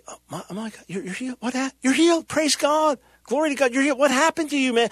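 high, breathless-with-wonder mock reaction; amazed rejoicing. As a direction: act out astonished delight, pitch climbing. Imagined joyful reaction to a healing.

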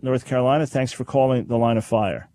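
ordinary delivery

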